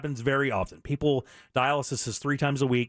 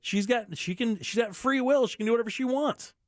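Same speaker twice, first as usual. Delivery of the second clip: pushed up high, exasperated at her free will; helpless exasperation; 9 semitones higher than usual. Real, not acted. Radio host venting to listeners, high-pitched exasperation. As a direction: pitch climbing high in frustration. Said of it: "what makes me so helpless".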